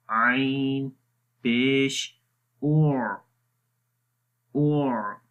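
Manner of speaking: slow drill of आ, एर, और with ain; recitation practice. Shown as a demonstration. Teacher leading students through ain practice. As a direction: chant each vowel of ain deliberately. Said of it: "तो आइए इसकी practice करते हैं".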